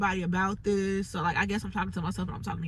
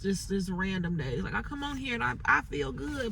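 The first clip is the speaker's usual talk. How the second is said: ordinary delivery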